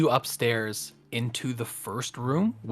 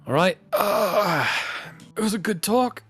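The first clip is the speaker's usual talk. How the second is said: higher, louder sigh into a friendly sign-off; hearty host calling it a night; genial innkeeper; 6 semitones higher, 5 dB louder than usual. Acted. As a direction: wrap up the evening heartily. Innkeeper winds down for bed.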